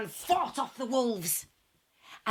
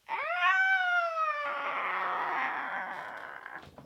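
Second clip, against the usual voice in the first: drawn-out gasp of amazement, pitched high; gasping as the witch melts; long high gasp. Acted. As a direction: gasp in shock.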